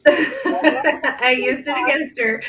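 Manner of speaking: slow, laughing admission; laughing confession. Real, not acted. Guest laughing as she owns using the mantra.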